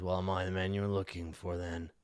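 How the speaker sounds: low, soft-spoken challenge; surly dog owner. Acted. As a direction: ask it low and quiet, as the surly stranger. Game master voices surly man.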